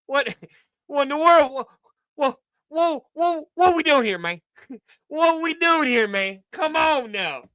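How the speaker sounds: high, laughing disbelief; baffled, high-pitched protest with laughs; incredulous. Real, not acted. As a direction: stammer it out high, cracking up in shock. Laughing disbelief at played clip.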